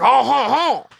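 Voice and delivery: high, hammed-up oh ho ho; mock French chuckle. Mimicking the French guy with a high mock chuckle. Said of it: "No, he didn't".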